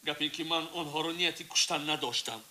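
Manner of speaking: ordinary talk, nothing marked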